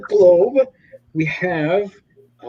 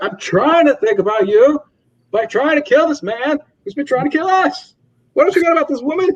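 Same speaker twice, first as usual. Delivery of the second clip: much higher, hammed-up arguing; mock angry Mark; 12 semitones higher, faster than usual. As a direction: play the hotheaded hero losing it.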